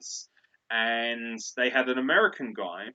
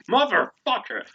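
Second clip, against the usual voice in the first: loud, high burst of swearing on demand; gleeful sweary outburst; 10 semitones higher, 7 dB louder, slower than usual. Pretend. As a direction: belt out the swear word for a fan. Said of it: "I love when he swears".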